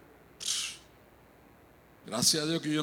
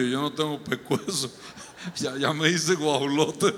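laughter breaking into the words; amused laughing. Real, not acted. Preacher joking about his own neck, laughing. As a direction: crack yourself up while talking. Cracks himself up mid-story.

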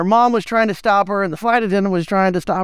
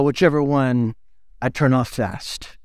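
deadpan dad; 9 semitones lower than usual. Retold or imitated. Dad's deadpan punchline to son.

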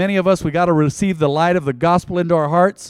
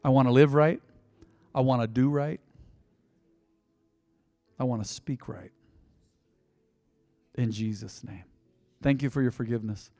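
quiet prayer; 5 semitones lower, 6 dB quieter than usual. Acted. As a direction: lower and much softer prayer.

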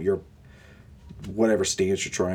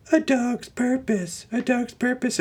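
very high, silly falsetto; mock falsetto; slower than usual. Comic mocking a dog movie's title, silly falsetto.

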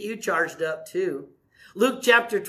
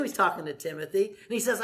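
ordinary talk, nothing marked

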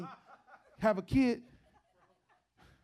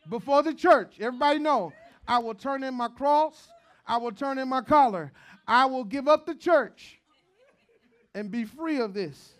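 shouted hammed-up vow, much louder; mock vow; 3 semitones higher, 12 dB louder than usual. Pastor jokingly vows to the church, full-force shout. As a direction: bellow it like a pulpit oath, all in fun.

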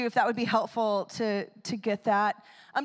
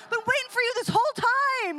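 put-on little boy voice; 13 semitones higher, 7 dB louder than usual. The boy greets the tattooed man in a high eager child voice. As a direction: play the excited kid who finally found him. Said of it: "exactly what he tells him".

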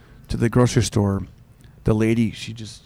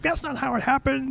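much higher indignant protest; imagined heckler; 13 semitones higher, 3 dB quieter than usual. Pretend. Imagining the lady in the audience, much higher. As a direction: squeal it like an offended heckler.

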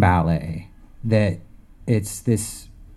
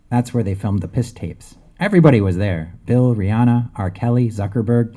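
lightly performed, lively satirical reading; reading aloud; 5 dB louder, faster than usual. Acted. Author reading the strange third section aloud, lightly performed. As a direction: read it like a deadpan satirical poem.

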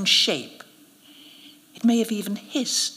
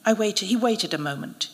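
usual speaking voice

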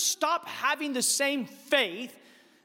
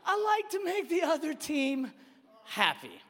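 mocking mimicry; 4 semitones higher than usual. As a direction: parrot the excuse back mockingly. Dad mockingly repeats son's excuse.

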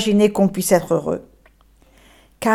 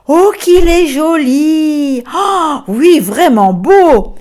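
high, slow, louder cries of wonder; delighted admiration; 5 semitones higher, 4 dB louder, slower than usual. Acted. Les enfants admirent l'âne, émerveillés. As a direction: gush over him like amazed children.

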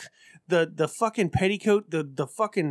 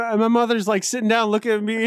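ordinary talk, nothing marked

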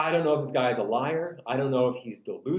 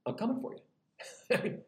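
slow, soft, higher-pitched threat; mock menace; 6 semitones higher, 8 dB quieter, slower than usual. Jesus warning Peter to forgive or else, softly menacing. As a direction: draw out a quiet warning. Acted warning of coming punishment.

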